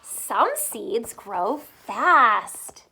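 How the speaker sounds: slow, deliberate storybook line; slow, clear read-aloud voice. Shown as a demonstration. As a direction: read it slowly so kids follow. Reading to children, slow.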